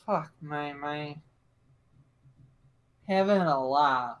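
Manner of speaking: slow, sinking dismay; weary exasperation. Acted. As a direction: groan the words as if at wit's end. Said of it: "head in hand".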